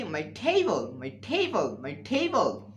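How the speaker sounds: table said slowly with an aspirated t; correct pronunciation. Shown as a demonstration. Teacher giving students the correct table. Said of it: "হবে কি?".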